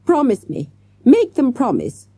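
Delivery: a desperate, dramatic plea; imploring. A narrator's reading. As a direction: beg him to make them swear it. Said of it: "she implored".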